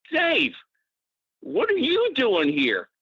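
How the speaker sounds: high, slow, astonished greeting; amazed greeting. Retold or imitated. Old friend amazed to meet Dave on the beach, drawn out high. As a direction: voice jumps up in happy disbelief.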